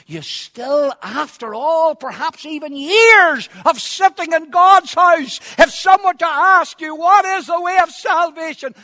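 loud, high-pitched preacher's cry; impassioned shouted sermon; full-force preaching. Acted. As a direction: thunder it out from the pulpit, stretching key words.

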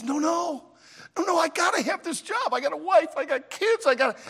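high, urgent pleading clown; begging clown. Acted. Clown begging the circus manager to keep his job, pleading. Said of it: "the clown just begged".

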